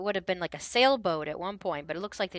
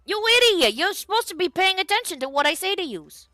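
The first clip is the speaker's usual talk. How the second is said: shrieking high, loud rebuke; furious bandit; 13 semitones higher, 10 dB louder than usual. Acted. Short bandit yelling at his big partner, shrill and loud. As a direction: scream at the fool for blabbing. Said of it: "And it's like".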